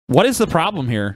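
normal talking voice

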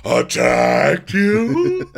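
slow, loud, high drawn-out question; mock disbelief. Two castmates question the attack claim, mock disbelief. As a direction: stretch it out, hammed-up incredulous. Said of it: "both at the same time go".

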